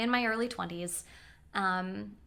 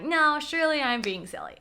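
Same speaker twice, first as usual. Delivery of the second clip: high, louder brush-off; self-dismissing; 6 semitones higher, 7 dB louder than usual. Retold or imitated. Passenger telling herself she is silly, dismissive. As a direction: talk yourself out of a worry. Reassuring herself the driver isn't mad.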